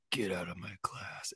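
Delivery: stern whisper. Retold or imitated. Teacher ordering the kid out, a stern whisper. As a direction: hiss it through your teeth like an angry teacher. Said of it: "she's like".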